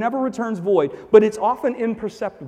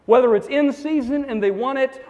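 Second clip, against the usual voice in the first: ordinary talk, nothing marked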